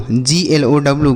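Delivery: ordinary delivery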